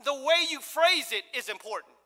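ordinary talk

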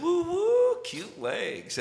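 flirty husband's wolf-call; playful catcall. Retold or imitated. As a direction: tease with a high hoot, then drop down. Husband teasing wife with catcall.

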